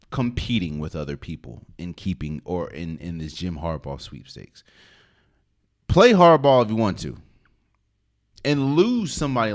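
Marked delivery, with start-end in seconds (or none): 5.9-9.5 emphatic loud. Real, not acted. Heated sports argument on podcast.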